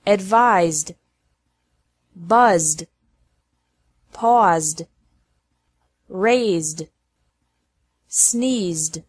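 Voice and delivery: "Buzzed", "Paused" ending in a z sound plus d. Shown as a demonstration. Teacher drilling verbs ending in Z sound, ED as D. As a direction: say "Advised", "Sneezed" one by one, closing on voiced zd. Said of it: "E D is pronounced like D".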